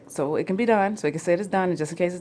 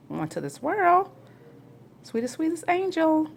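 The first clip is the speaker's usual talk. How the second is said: doting; 11 semitones higher than usual. Real, not acted. Doting on firstborn son.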